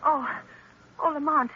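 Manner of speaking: anxious relief. Acted. Margot anxious at reunion.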